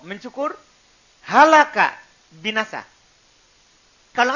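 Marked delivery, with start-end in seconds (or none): 1.3-1.9 Halaka with a loud breathy middle-throat h. Shown as a demonstration. Teacher contrasting words for students, loud breathy h. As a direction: push a strong h through Halaka.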